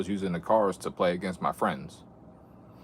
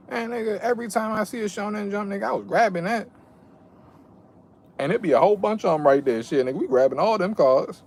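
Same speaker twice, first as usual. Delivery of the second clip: excited; 12 semitones higher, 4 dB louder, faster than usual. Real, not acted. Excited memory of grabbing magazines.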